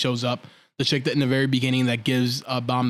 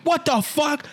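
high-pitched retold outburst of disbelief; shocked; 11 semitones higher, slower than usual. Viewer recalls watching the betrayal, high shocked outburst. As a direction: relive the jaw-drop, voice leaping up. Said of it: "I was in shock".

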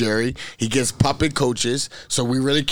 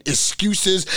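usual speaking voice

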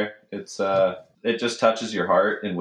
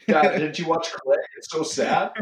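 put-on a higher, pleading kid voice to his dad; mock whiny son; 7 semitones higher than usual. Joking as the crying son to his dad, higher and whiny. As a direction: whine it like a hurt teenage boy.

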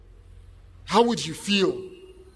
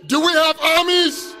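raised, higher call to the crowd; rallying shout; 4 semitones higher than usual. Real, not acted. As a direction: work the crowd with a shouted question.

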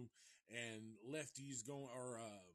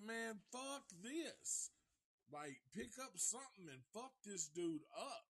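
pretend fed-up self; 8 semitones higher than usual. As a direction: blow up like you've had enough. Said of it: "I'd have been like".

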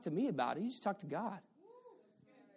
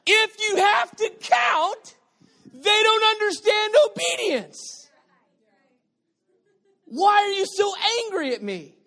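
preaching shout; 8 semitones higher, 7 dB louder than usual. Real, not acted. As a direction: hammer it home. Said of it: "Why am I talking like this?".

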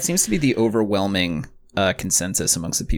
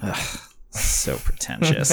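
disdainful; 5 semitones higher, much slower than usual. Pretend. Mock disdain.

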